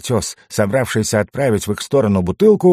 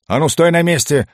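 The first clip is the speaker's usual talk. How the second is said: sharp order; 6 semitones higher than usual. A narrator's reading. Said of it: "осадил его Угорь".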